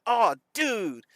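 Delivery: put-on excited kid. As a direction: relive a kid's thrill over a toy. Imitates childhood excitement over Hot Wheels.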